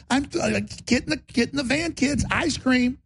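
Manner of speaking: high sly wheedling offer; Warren Buffett luring kids. Retold or imitated. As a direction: coax like a stranger with candy. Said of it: "Warren Buffett is always pictured with an ice cream cone".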